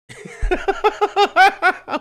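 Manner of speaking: a sudden high, loud burst of laughter; squealing laugh. Real, not acted. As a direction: crack up at the chat. Laughing at a chat message.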